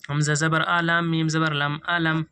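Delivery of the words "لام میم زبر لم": ordinary talk, nothing marked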